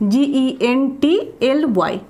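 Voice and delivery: normal talking voice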